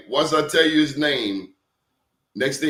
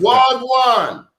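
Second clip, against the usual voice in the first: loud, high Jamaican "Wagwan" with a falling tune; Jamaican greeting; 10 semitones higher, 8 dB louder than usual. Retold or imitated. Guest defiantly greeting in his Jamaican accent. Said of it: "my Jamaican accent".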